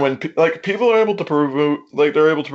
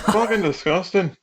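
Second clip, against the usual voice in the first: higher-pitched, put-on Scottish accent; bad Scottish accent; 5 semitones higher than usual. Shown as a demonstration. Caller doing it out of defiance, amateur Scottish accent. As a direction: have a go at a poor Scottish accent. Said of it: "Scottish accent, but I'll do it".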